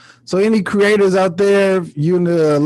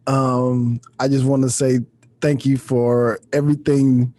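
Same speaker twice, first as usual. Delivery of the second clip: prayer; 6 semitones lower than usual. Pretend. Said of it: "I ain't doing prayers".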